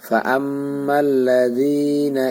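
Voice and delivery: আমাল্লাযীনা stretched very slowly with a nasal ghunnah; Quranic recitation. Shown as a demonstration. Teacher modelling ghunnah to the students in recitation. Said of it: "এখানে গুন্না করতে হবে".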